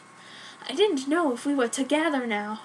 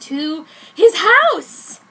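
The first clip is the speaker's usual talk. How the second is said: thrilled reveal; 6 semitones higher, 12 dB louder, much slower than usual. A narrator's reading.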